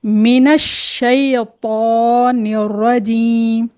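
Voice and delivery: मिनश शैतानिर रजीम recited very slowly and carefully; slow recitation. Shown as a demonstration. Teacher reciting again slowly for the students. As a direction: chant मिनश शैतानिर रजीम, drawing out each syllable. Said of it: "मैं फिर से पढ़ती हूँ".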